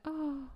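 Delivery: high imitated little "oh"; little swooning noise. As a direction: mimic her small kissed gasp. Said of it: "She actually makes a little noise".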